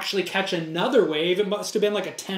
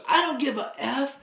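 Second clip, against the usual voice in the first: mock shout; 7 semitones higher than usual. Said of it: "He goes".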